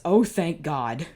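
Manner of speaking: slow, loud breathless relief; letting the tension out; relieved gasp. Real, not acted. Reader relieved the wetness was only blood, slow loud relief.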